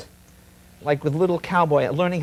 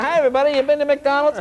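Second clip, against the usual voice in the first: high, loud cheesy host greeting; mock host shout; 12 semitones higher, 5 dB louder, faster than usual. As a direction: yell it like a desperate cruise entertainer. Mock host greeting drunk crowd.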